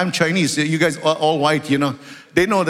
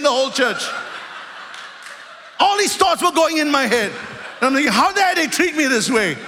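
laughing at himself; 10 semitones higher than usual. Retold or imitated. Retold proud thoughts, laughing.

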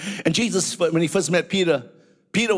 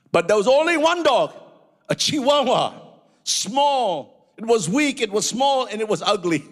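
excited storytelling; 8 semitones higher than usual. Acted. Preacher telling about the brave Chihuahua, excited. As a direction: build up the little dog. Building sermon illustration.

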